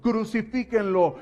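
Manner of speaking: slow, loud, drawn-out crucifíquenlo; furious crowd shout. Acted. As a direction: bellow crucifíquenlo as the angry mob. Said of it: "estaban gritando".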